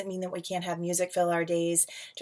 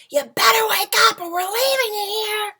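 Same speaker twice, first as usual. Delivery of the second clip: much higher, louder big-sister voice; big sister urging her sleepy brother to wake up; sister waking him; 11 dB louder than usual. Acted. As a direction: pop up high and loud as the bossy sister.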